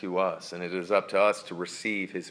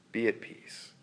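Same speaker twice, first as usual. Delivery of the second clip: calm someone close to you; Jesus whispering be at peace; whispered reassurance; 2 semitones higher, 5 dB quieter than usual. Acted.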